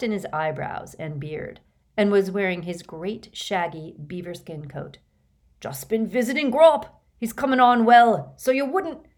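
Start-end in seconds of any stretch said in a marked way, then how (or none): 5.6-9.1 loud, hearty, higher giant; booming cheerful giant; Hagrid voice. Acted.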